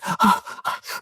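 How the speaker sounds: sharp breathy gasp, high; mock gasp. Imitating the elderly woman gasping after running up the ramp. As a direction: gasp for air like she's winded. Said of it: "she's all like".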